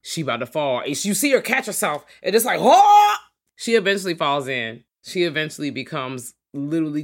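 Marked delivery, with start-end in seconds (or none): 2.6-3.2 very high, loud yelp; mock startled scream. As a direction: shriek as if about to topple in. Acting out her falling in.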